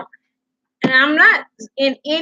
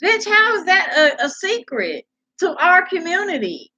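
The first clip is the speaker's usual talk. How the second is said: higher-pitched exasperated retort; indignant challenge; 8 semitones higher than usual. Real, not acted. Vlogger rebutting the woman's secret claim, indignant. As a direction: throw the question back, incredulous.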